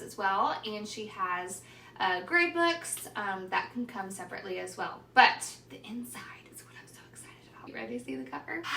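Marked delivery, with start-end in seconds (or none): 5.7-8.6 hushed, giddy whisper; breathy whisper. Real, not acted. Teacher whispering to viewers before the big reveal. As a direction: whisper it like a secret you can't wait to share.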